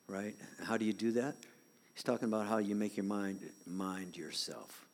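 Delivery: normal talking voice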